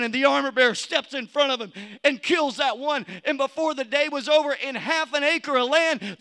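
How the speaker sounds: ordinary talk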